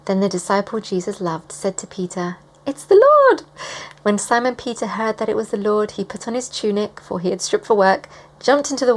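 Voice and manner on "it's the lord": high, loud exclamation; overjoyed shout to a friend; excited recognition. Acted. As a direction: cry it out in thrilled amazement. Acts disciple recognising Jesus.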